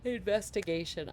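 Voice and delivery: word stretched by a yawn. Real, not acted. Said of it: "I keep yawning".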